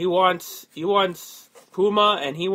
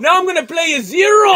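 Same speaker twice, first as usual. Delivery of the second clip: mock rocker voice; 10 semitones higher, 9 dB louder than usual. Mocking [name], high pitched.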